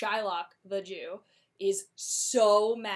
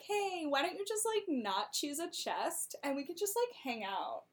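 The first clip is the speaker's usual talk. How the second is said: coaxing; 7 semitones higher, 4 dB quieter than usual. Retold or imitated. Portia coaxing Bassanio.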